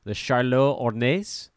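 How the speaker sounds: "The Charlotte Hornets?" in a slow mock French accent, Hornets as "or-neh"; terrible French accent. Host joking about the team name, terrible French accent. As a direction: say "Charlotte Hornets" like a bad French waiter, slowly. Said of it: "with a terrible French accent".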